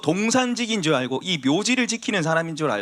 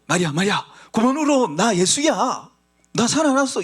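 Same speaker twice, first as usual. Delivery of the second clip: higher, louder tender call to Mary; Jesus comforting Mary; 5 semitones higher, 4 dB louder than usual. Acted. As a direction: gently call her name, then lift the voice to comfort her. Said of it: "예수님이 가셔가지고". (마리아를 위로하는 예수님의 다정하고 높은 목소리)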